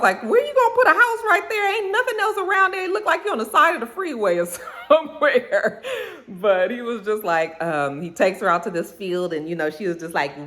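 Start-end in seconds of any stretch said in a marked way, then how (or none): 0.2-5.8 high-pitched incredulous outburst, breaking into laughter; mock incredulous. Viewer reacting to the empty house site, mock incredulous. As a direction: squeal it in disbelief, half laughing. Retold reaction to the remote field.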